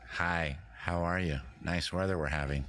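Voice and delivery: a lightly voiced bland neighbour making polite chatter; bland Connecticut small talk. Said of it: "Connecticut's a bit".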